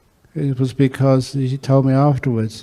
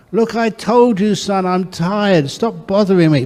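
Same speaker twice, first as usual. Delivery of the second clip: tired father snapping at his nagging son; irritated father; 7 semitones higher than usual. Acted. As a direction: lose patience with the kid. Father snaps at pestering son.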